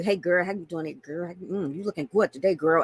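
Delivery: sleazy come-on, lightly voiced; mild mock flirt from a club creep; creepy pickup line. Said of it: "the creepy guy ... comes up to you".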